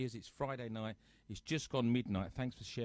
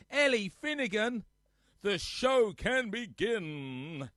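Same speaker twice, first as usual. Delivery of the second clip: mock announcer; 9 semitones higher, 9 dB louder, much slower than usual. Grand announcing of a viewer.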